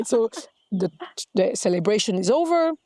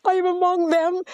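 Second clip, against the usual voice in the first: higher, slow, breathless wonder; awed inner voice; 8 semitones higher than usual. Retold or imitated. Seated among kings, her inner voice in high slow awe. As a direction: marvel at it slowly, high and hushed. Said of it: "and I'm like".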